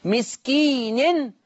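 مسكين drawn out with final nin; slow recited word. Shown as a demonstration. Teacher showing Hafs reading of مسكين, drawn out. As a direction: recite مسكين slowly, nun with kasra and tanween. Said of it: "كسر النون مسكين".

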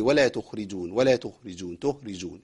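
تخرجون mumbled softly with the kh lost. Shown as a demonstration. Teacher mocks students who drop the kh. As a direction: swallow the kh in تخرجون. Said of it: "فين يا عم الخاء".

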